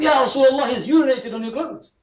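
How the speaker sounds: acting out an alarmed complaint; retold scream. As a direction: plead the case in a high mock cry. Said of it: "Why are you screaming like that?".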